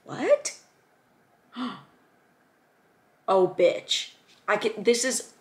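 very high, rising what; astonished. Real, not acted. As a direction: squeak it in disbelief. Astonished by study session page.

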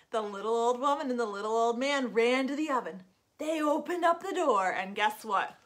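put-on lively read-aloud for kids; storytelling. A narrator's reading. Children's storyteller at story time. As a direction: tell it like a children's librarian.

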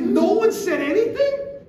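high, loud, drawn-out disbelieving question; retold outburst of sheer disbelief; incredulous outburst. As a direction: cry it out, appalled and incredulous. Husband stunned that nobody spoke up.

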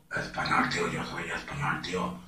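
low, soft, husky Castilian; hoarse Spanish accent. Shown as a demonstration. Showing step two to viewers, hoarse Castilian accent. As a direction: add rasp to the Spain accent, dropping low. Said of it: "Segundo paso".

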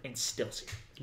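sly breathy aside; whispered aside. Real, not acted. Echoing friend's line as a sly whispered aside. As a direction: mutter it slyly under the breath. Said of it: "sly repeat of friend's line".

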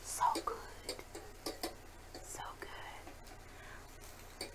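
hushed murmur of delight; whisper. Real, not acted. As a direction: breathe it out softly. Whispered savoring of food.

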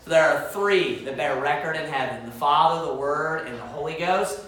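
low, soft, measured verse reading; scripture recitation. Acted. Preacher reciting First John to the church, low and solemn.

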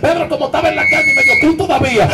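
loud, punchy sermon storytelling over music; fiery preaching. Acted. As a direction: hammer the story home to the congregation.

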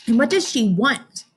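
ordinary talk

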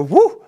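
loud, high-pitched "woo"; excited whoop. Real, not acted. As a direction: whoop it out in celebration. Cheers Finnish war heroes.

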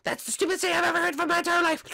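high cartoon mom voice; sing-song motherly cartoon voice; mother greeting her son. Plays Marge the mom.

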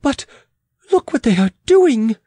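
high, alarmed exclamation; exclaiming. Acted. Queen alarmed at the besiegers, exclaiming. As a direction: exclaim urgently, pitch jumping up. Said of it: "exclaimed the Queen".

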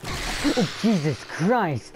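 real jump-scare shriek, loud and high; startled scream. Real, not acted. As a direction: yelp in genuine fright. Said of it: "Jump scared me".

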